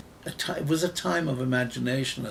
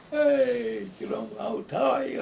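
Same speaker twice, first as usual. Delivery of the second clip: much higher and louder, a big open hello; demonstrative greeting; 10 semitones higher, 4 dB louder than usual. Retold or imitated. Imitating demonstrative Mediterranean people greeting someone, effusive and high. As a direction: throw your arms wide and greet them with gusto. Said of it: "are very demonstrative".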